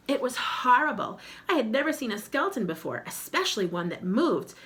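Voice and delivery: plain reading voice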